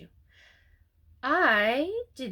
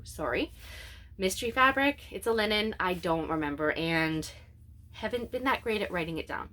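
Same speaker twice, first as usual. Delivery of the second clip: normal talking voice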